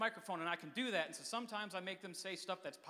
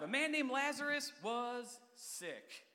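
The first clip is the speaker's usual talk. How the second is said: slow dramatic reading; 4 semitones higher, much slower than usual. Acted. Dramatic scripture opening.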